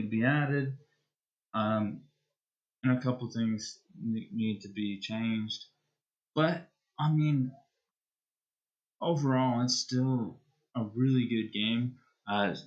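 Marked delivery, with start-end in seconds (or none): none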